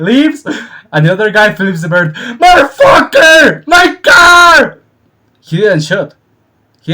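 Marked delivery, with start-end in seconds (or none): 2.1-4.8 high, loud shouted outrage; furious yell. Retold or imitated. Robbed owner at the thief: high furious shout. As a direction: scream after the thief.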